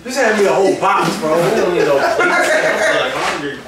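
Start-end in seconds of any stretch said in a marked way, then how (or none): 2.2-3.6 laughing. Real, not acted.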